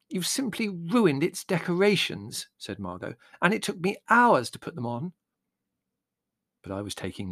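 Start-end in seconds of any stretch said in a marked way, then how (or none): 0.1-2.5 slow, high, plaintive complaint; aggrieved young woman. 3.4-5.1 high-pitched injured complaint; aggrieved young woman.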